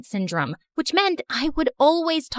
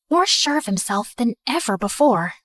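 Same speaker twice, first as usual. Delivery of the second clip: plain reading voice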